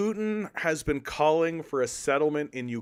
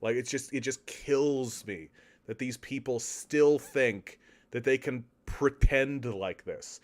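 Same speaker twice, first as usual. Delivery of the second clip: ordinary talk, nothing marked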